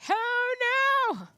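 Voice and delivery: a sudden high, loud oh no; startled cry as the bushes vanish; surprised yelp. Real, not acted. Player finding bushes gone, surprised yelp.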